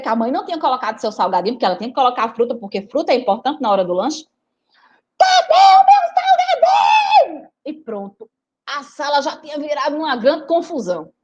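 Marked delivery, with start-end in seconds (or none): 5.2-7.5 put-on high, loud, slow child's shriek; child screaming. Rita screaming in class for her snack, high and slow. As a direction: howl for the missing snack. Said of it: "ela só vivia gritando".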